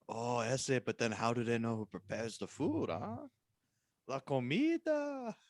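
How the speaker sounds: soft, lightly voiced Mexican accent; understated Mexican accent; mock Mexican accent. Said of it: "Juan character replies".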